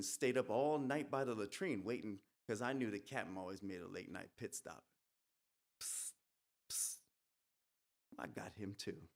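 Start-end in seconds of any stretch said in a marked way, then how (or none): 5.8-7.0 two quick hisses, softly done; quiet repeated psst; psst sound. Acted. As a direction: hiss like a small puff of air. Imitates needle injecting air.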